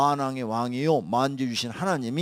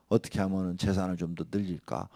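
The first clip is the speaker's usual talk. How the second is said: ordinary talk, nothing marked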